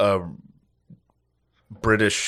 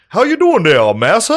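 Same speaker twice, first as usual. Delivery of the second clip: high, loud put-on greeting; mock reenactor greeting; 11 semitones higher, 7 dB louder than usual. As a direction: ham up the slave reenactor's hello.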